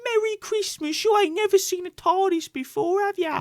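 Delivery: high, loud mock Cockney accent; hammed-up, high-pitched Cockney accent greeting; changed accent. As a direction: squawk it as an over-the-top Cockney. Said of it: "Her accent keeps changing as well".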